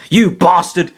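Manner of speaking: furious yell at the enemy; angry shout. Acted. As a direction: spit it out in rage. Mewtwo yells at Master Hand.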